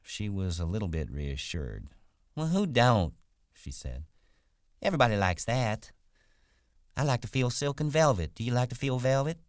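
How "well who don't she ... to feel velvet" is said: much higher, louder country woman; chatty wife. Acted. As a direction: chat eagerly in a high wife's voice. Voices Curley's wife, reassured.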